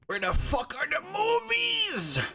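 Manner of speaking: high frustrated yell; shouting. Real, not acted. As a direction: yell it out, exasperated. Shouting, searching for movies.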